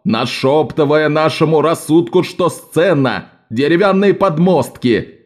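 declaiming. Shown as a demonstration. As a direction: hold the whole theatre in your hand. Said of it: "изменил голос и позу и ... продекламировал". (торжественная декламация хора)

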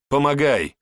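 shouted command. A narrator's reading. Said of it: "велел я ему".

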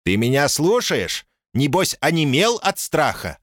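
mocking American accent. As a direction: sneer it in a put-on American accent. Caller taunts in Dickie voice.

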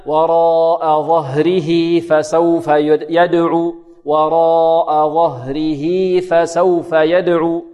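slow chant holding ظهره as "ẓahrihī" into فسوف; linking yā. Shown as a demonstration. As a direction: draw out وراء and the final ī of ظهره. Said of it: "وصلناها بياء وصلًا". (تلاوة بصلة هاء ظهره بياء)

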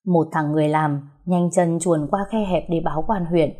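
plain reading voice

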